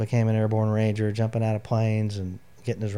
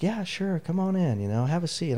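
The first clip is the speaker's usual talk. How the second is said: chaplain's welcome; 8 semitones higher than usual. Retold or imitated. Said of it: "And he's like".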